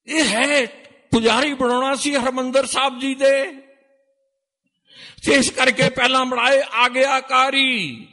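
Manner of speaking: high, loud, ringing sermon voice; preaching at full force. Acted. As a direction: proclaim it to the whole sangat. (ਉੱਚੀ ਸੁਰ ਵਿੱਚ ਜੋਸ਼ੀਲਾ ਪ੍ਰਚਾਰ)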